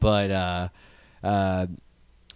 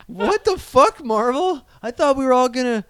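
high, fast outraged disbelief; mock outrage; 11 semitones higher than usual. Host rants at Marvel in pretend dismay, high and outraged. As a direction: ham up the betrayal.

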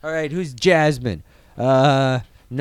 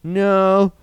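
louder and fast, suspicious retold questioning; mock usher challenge; 4 semitones higher, 5 dB louder than usual. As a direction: fire the question fast and loud like a nosy usher. Usher questions kids, kids deny.

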